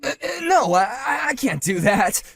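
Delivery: high, stuttering, near crying; stammering panic. Acted. Husband caught bluffing about gangsters, stammering panic. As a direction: scramble for words, caught out.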